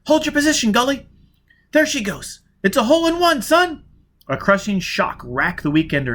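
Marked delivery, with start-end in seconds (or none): 0.1-3.8 higher woman's voice, eager and quick; a young woman pitching a daring plan; Jiz, urgent plan. Acted. Jiz urging Foyle to blast the safe, eager woman's voice.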